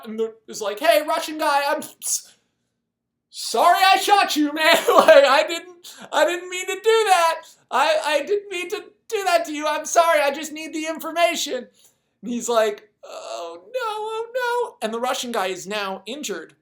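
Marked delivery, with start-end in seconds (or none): 0.8-11.7 shouted, much higher, almost pleading yell; frantic apology. Retold or imitated. American yelling sorry to the Russian he shot, frantic high shout. 13.0-14.8 each oh no climbing higher and louder; rising dismay. Retold or imitated. Sniper realizing he shot the wrong man, climbing panicked wail.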